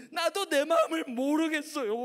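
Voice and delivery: high, loud acted despair; wailing schoolgirl. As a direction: cry out helplessly to the pastor. Girl wails in despair.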